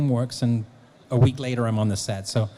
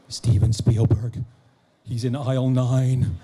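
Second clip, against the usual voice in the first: slow, louder, weighty reveal; awestruck announcement; 4 dB louder, slower than usual. Retold or imitated. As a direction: imitate stunned news, drawn out for effect. Wife's stunned news, retold.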